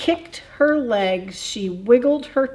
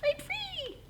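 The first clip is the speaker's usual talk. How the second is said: very high, soft squeak of relief; a wee bug sighing with freedom; tiny bug voice; 12 dB quieter than usual. A narrator's reading. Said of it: "the buggy says".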